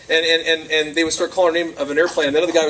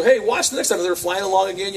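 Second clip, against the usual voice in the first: ordinary delivery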